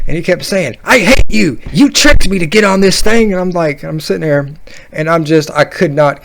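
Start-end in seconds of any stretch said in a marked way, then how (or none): 0.8-3.3 high, loud mock tantrum; yelling kid. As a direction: imitate a boy screaming in outrage. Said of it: "he just yells at the top of his lungs".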